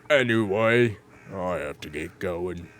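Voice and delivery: normal talking voice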